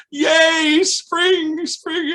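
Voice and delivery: very high, loud cheer; loud high chant of joy; kids cheering. Retold or imitated. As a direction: cheer like a room of toddlers.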